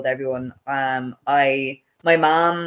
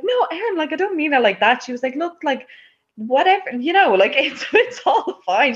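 lightly voiced mother, high and soothing; hint of a mother soothing her upset daughter; reassuring mom; 12 semitones higher than usual. Mom calming her crying daughter, light high reassuring voice.

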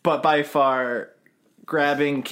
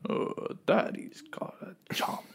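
slow, soft whispered villain impression; a hushed, badly faked supervillain, breath over voice; Thanos; 5 semitones higher, 10 dB quieter than usual. Podcaster imagining a villain over the Avengers, shitty hushed impression. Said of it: "that's a shitty Thanos".

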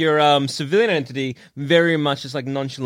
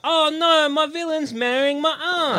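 whiny Peter Parker; 10 semitones higher, slower than usual. Pretend. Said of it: "was like".

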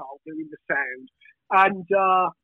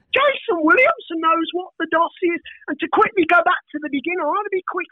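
ordinary delivery

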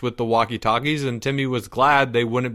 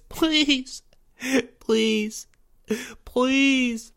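very high, drawn-out tearful pleas; blubbering begging; 13 semitones higher, much slower than usual. A narrator's reading. Boy begging his classmates for mercy, blubbering. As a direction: beg in a squeaky, blubbering whine. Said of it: "begged and blubbered uncontrollably".

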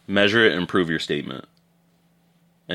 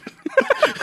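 short, very high giggle; high squeaky laugh. Real, not acted. Cohost giggles high about fighting for free land.

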